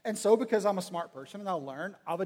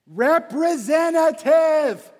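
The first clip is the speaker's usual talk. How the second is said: slow, high, very loud shout; exasperated shout; 8 semitones higher, 11 dB louder, much slower than usual. Acted. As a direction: yell it syllable by syllable at the phone menu. Repeats it at phone system.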